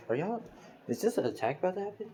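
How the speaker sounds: high-pitched, rising incredulous query; puzzled question. A narrator's reading. Deku questions villains coming out at him, puzzled and high. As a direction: ask it high, climbing to the end.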